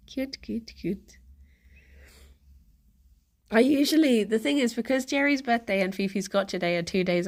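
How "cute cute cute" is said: quiet, falling repeated cute; soft cooing. Real, not acted. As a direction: murmur cute, cute, cute softly.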